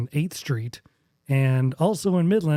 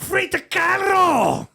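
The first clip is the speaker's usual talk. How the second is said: high, drawn-out shout in a mock German accent; screamed German-accent attempt that sounds Cuban; 3 dB louder, slower than usual. Shown as a demonstration. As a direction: bellow it in a bad German accent, R rolled. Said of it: "do it with a German accent".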